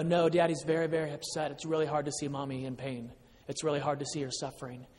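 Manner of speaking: soft, low, subdued; gentle sad honesty. Retold or imitated. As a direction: confide softly in a young child. Father gently admitting sadness to son.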